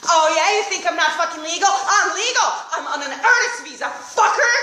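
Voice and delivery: hammed-up outraged comeback; indignant retort. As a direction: snap back with a laugh. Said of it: "I'm like".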